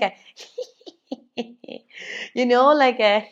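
ordinary talk

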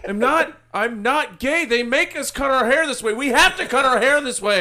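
defensive detective. Pretend. Acting defensive detective in skit.

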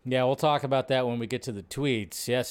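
ordinary talk

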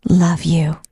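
tender whisper. Real, not acted. Tender farewell hug with girl.

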